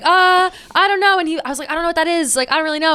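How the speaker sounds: high, small nervous denial; a fifth grader lying to her dad, high and nervous. Retold or imitated. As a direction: squeak out a guilty excuse.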